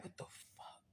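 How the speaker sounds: barely-there whispered disbelief; silent inner whisper. Retold or imitated. Staring at the judge, an inner hushed whisper. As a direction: mouth it almost soundlessly. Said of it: "I ain't say it like that".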